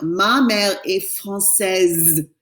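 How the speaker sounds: "Ma mère est française" said slowly, the final Z of française sounded clearly; teacher modelling the feminine ending; slow French model sentence. Shown as a demonstration. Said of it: "the S has to be pronounced".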